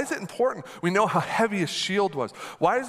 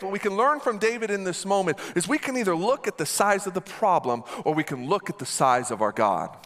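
normal talking voice

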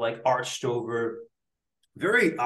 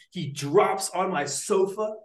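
usual speaking voice

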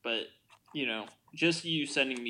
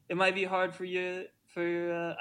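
put-on higher-pitched, failed Dutch accent; bad Dutch accent; 6 semitones higher than usual. Quoting footballer to English press, badly faked Dutch accent. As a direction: attempt a Dutch accent and falter. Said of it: "I can't do a Dutch accent".

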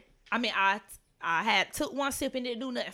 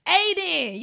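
louder, higher call across the field; yelling a name; 8 semitones higher, 7 dB louder than usual. Retold or imitated. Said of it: "yelling Aiden".

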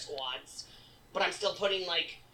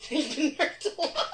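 higher, louder, laughing through it; 10 semitones higher, 6 dB louder than usual. Real, not acted. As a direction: laugh as you repeat it. Repeats line laughing.

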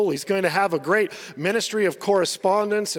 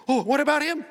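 higher, laughing "what about him" whine; mock whiny Peter; 8 semitones higher than usual. Preacher mocking Peter's jealous question with a laugh. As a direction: tease Peter's jealous glance at John. Peter comparing himself, mocked.

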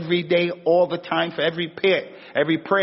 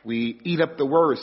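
usual speaking voice

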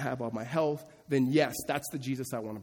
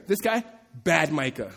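ordinary delivery

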